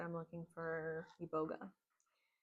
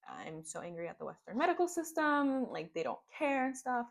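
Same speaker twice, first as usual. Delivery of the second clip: retold anger; 6 semitones higher, 6 dB louder than usual. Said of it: "angry and frustrated".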